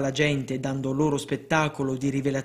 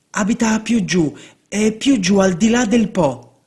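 excited seer; 6 semitones higher, 6 dB louder than usual. Acted. Seer insists, carried away.

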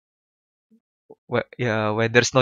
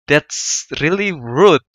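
drawn out, louder and higher; slow emphatic rebuke; 3 semitones higher, 5 dB louder, much slower than usual. Real, not acted. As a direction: stretch every word in disbelief. Hurt reaction to the insult.

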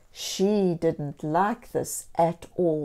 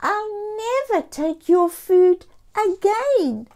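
high, loud kitty promise; the cat vowing to her friends; earnest cat vow; 13 semitones higher, 5 dB louder than usual. A narrator's reading. Sushi's vow voiced.

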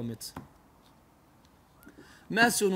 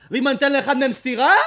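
emphatic preaching; 9 semitones higher, 14 dB louder than usual. Acted. Preacher's heated example of slapping.

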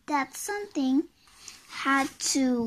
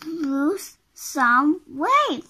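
slow, higher, emphatic command; bossy order; 3 semitones higher, 4 dB louder, much slower than usual. Acted. Bossy character orders Mister Greedy, slow and rude. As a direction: spell it out rudely, word by word.

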